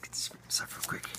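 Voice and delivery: hushed whispered aside; a quick secret aside, fully whispered. Real, not acted. Aside to someone off camera.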